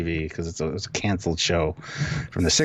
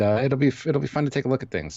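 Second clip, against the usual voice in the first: ordinary talk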